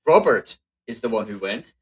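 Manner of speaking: "Robert" punched at the start; single out "Robert" as the one; stress on Robert. Shown as a demonstration. Teacher showing English emphasis, stressed "Robert". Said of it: "on the Robert".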